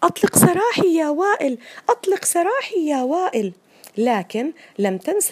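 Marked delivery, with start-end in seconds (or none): 0.0-1.6 high, loud shout stressing سراحي; loud cry. Acted. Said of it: "لصاح بأعلى صوته". 1.9-3.5 high cry. Acted. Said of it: "لصاح بأعلى صوته".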